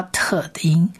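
whispered 特 the unvoiced t sound; breathy sound demo. Shown as a demonstration. Teacher shows unvoiced t to listeners, whispered demo. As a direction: let 特 come out on breath only. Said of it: "发特的音".

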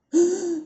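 high, acted gasp of alarm; sudden frightened intake of breath; startled gasp. Princess is startled and afraid.

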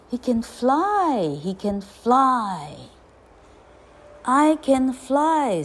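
slow, stretched exclamation; jubilant. A narrator's reading. Ugly duckling discovers flight, exclaiming slowly in wonder. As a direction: draw out each cry in wonder.

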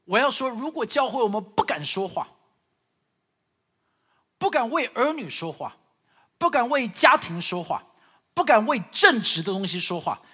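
loud, impassioned pulpit delivery; fervent preaching. Acted. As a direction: preach it out with full force. Building sermon climax. (激昂讲道)

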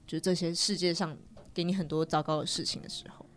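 ordinary delivery

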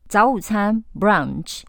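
usual speaking voice

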